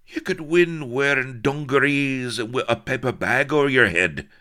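high, loud, desperate babble; anguished, urgent babbling. A narrator's reading. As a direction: flatter her frantically in a brogue. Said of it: "babbled Blood".

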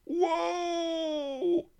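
very high, long wail of a frightened rabbit. A narrator's reading. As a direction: cry out in dread as he runs down. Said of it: "wailed the Key Rabbit".

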